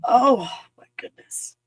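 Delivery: slow, breathy whisper of dismay; overwhelmed whisper. Real, not acted. Upset vlogger overwhelmed by injustice, whispering slowly.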